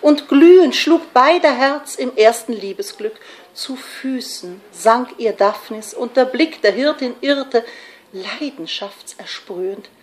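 loud, lifted verse that sinks to a hush; fervent romantic verse reading; impassioned poetry recitation. Acted. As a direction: swell with passion, then soften.

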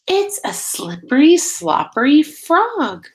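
put-on spring the surprise on the children; surprised reveal. Teacher revealing the frog to her class, delighted surprise.

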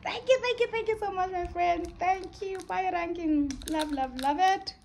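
high, loud gushing thank-yous; excited squealing thanks. Real, not acted. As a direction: gush with delight.

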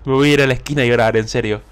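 usual speaking voice

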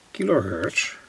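ordinary delivery

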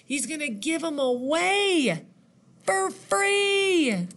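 high, excited astonishment; amazed. Pretend. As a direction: play up the big reveal for a child. Reader reacts to story's reveal.

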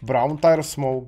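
ordinary delivery

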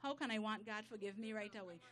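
usual speaking voice

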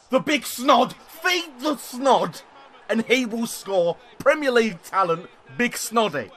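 high, loud, drawn-out hype; hyped praise. Real, not acted. Gamer hyping his player after a goal, high loud chanting. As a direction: chant his name like a fan.

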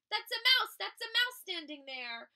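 high, loud storybook alarm; frightened elephant outcry; panicked shouting. A narrator's reading. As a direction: shout in fright, starting at the top. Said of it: "shouted the elephant".

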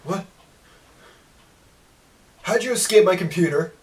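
usual speaking voice

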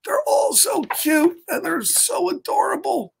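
high-pitched cooing over cute animals; gushing. Acted. Reader shows children the adorable pets, high gushing coo. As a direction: gush as if melting at the pets.

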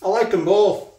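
talking through a mouthful; words round a bite of food; mouth full. Real, not acted. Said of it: "talking with me mouth full".

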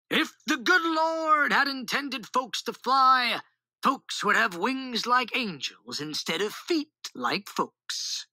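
slow, high-pitched pulpit oratory; preacher's voice. A narrator's reading. Papa sermonizes against folks flying, in a preacher's voice. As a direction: preach it slowly, stretching the words. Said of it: "Papa rumbled in his deepest preacher's voice".

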